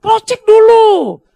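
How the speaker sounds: loud slow command. Real, not acted. Preacher commands congregation.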